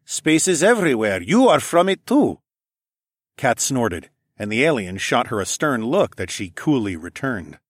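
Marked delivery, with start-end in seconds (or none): 0.0-2.4 deadpan and blunt. A narrator's reading. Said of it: "bluntly replied".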